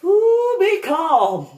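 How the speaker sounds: high, loud giggling sing-song between the words; manic mock laughter. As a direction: laugh like a cartoon villain holding it together. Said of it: "So I'd look it up going".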